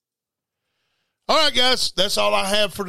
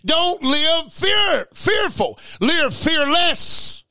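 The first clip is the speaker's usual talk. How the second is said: preaching shout; 9 semitones higher, much slower than usual. Real, not acted. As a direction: hammer each word out to the flock. Preacher exhorting his listeners.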